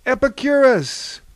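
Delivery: high, loud call of a name; yelled greeting. A narrator's reading. The group greets Pan at the door, yelling. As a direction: yell a welcome across the room. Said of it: "yelled the group".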